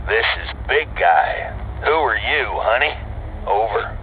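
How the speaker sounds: gruff trucker voice on the radio; over CB radio. Acted. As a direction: answer through a crackly CB.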